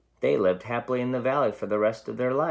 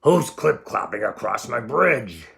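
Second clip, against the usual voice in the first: loud roaring troll; 2 semitones higher, 6 dB louder than usual. Acted. Troll challenging the goat on his bridge, roaring. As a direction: bellow up from under the bridge. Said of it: "A roar rose up from below".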